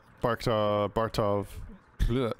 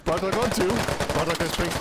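excited play-by-play; 6 semitones higher than usual. Real, not acted. Caster calls the final kills.

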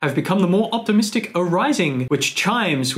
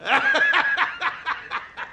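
a short high laugh breaking out; real laugh; 13 semitones higher than usual. Real, not acted. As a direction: laugh at your own joke. Laughs at his own summary.

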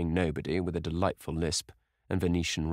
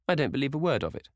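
put-on quick, high disbelieving old lady; scoffing hostess; 8 semitones higher, faster than usual. A narrator's reading. Hostess scoffing at Lord Henry's gossip, quick and high. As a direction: rattle it off in disbelief.

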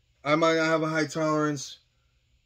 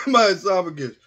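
coughing; 4 semitones higher, 7 dB louder than usual. Real, not acted. Coughing on hot spice.

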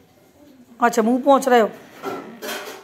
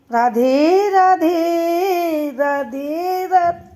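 slow demo for parrot; much slower than usual. Shown as a demonstration. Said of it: "Radhe Radhe करके सो जाओ".

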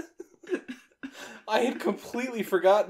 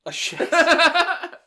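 high, slow, laughing disbelief; 10 dB louder, much slower than usual. Real, not acted. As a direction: draw out Shasta through laughter. Mocking the cheap soda.

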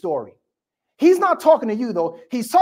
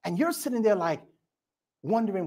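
ordinary talk

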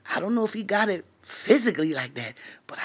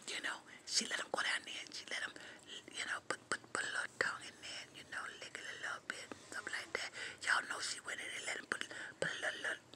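whisper; 18 dB quieter, faster than usual. Real, not acted. Racy gossip whispered.

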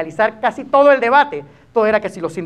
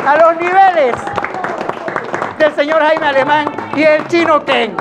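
loud, high-pitched hype send-off; big build to the guest's name; variety-show host throwing to break; show announcer; 8 semitones higher, 6 dB louder, slower than usual. Acted.

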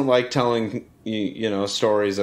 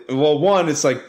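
ordinary delivery

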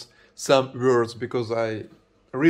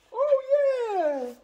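excited. Real, not acted. Money found in the package.

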